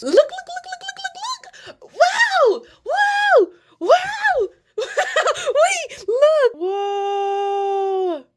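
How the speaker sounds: very high, loud squeals of delight; ecstatic squealing. Real, not acted. Showing viewers her curls finally defining, ecstatic squeals. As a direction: shriek with pure joy.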